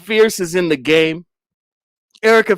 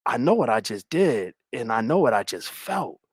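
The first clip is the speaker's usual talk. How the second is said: low, soft, weighty delivery; low mock-dramatic confession; acted confession; 5 semitones lower, 8 dB quieter than usual. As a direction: act out a hushed, serious admission. Acts tense, intimate confrontation.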